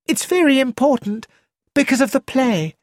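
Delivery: Rosamond, high and a touch anxious; a hint of worry in a high young voice; worried. A narrator's reading. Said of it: "Rosamond looked worried".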